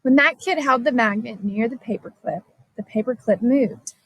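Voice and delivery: ordinary delivery, nothing marked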